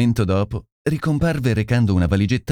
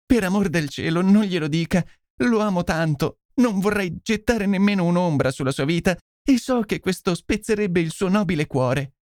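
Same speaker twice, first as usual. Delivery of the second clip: higher-pitched, distraught pleading; sconvolta e supplichevole; 9 semitones higher than usual. A narrator's reading. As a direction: beg him as an overwrought lady. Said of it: "lo scongiurò sconvolta e supplichevole". (sconvolta, con voce supplichevole)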